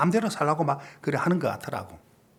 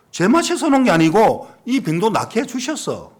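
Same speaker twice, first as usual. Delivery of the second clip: fervent preaching; 5 semitones higher, 3 dB louder than usual. Retold or imitated. Pastor preaching to sick youth.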